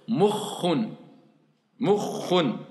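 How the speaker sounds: "Moukhun" with the kh held long. Shown as a demonstration. Teacher holds the kh in "Moukhun" for the class. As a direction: lean on the kh in "Moukhun". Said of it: "je vais rester deux fois dessus".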